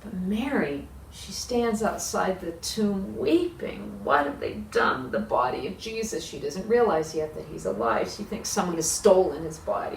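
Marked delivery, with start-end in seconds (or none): none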